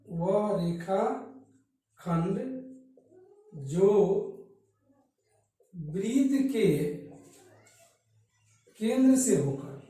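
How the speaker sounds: word by word, long pauses between phrases; slow dictation. Shown as a demonstration. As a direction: dictate slowly for students to write down. Dictating a definition for writing.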